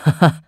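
short mocking haha; brief teasing chuckle; gruff laugh. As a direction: laugh at the startled child. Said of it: "rief er".

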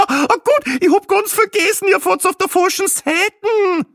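shrieky high, loud laughing outburst; hysterical laughter. A narrator's reading. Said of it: "entfuhr ihr ein geradezu hysterisches Lachen".

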